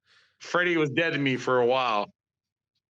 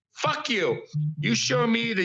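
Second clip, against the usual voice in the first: higher-pitched acted dismissal; mock rebuff; 6 semitones higher than usual. Creator refusing someone who wants everything, mock rebuff. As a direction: throw out a sudden rebuff.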